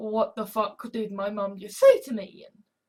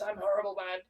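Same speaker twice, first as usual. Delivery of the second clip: ordinary talk, nothing marked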